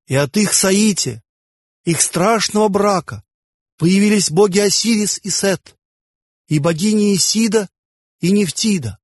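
high, ringing ceremonial declamation; solemn ringing proclamation. A narrator's reading. Playwright opening his mystery play before the audience, solemn ringing proclamation. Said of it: "голос мой торжественно зазвенел".

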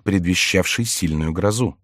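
usual speaking voice

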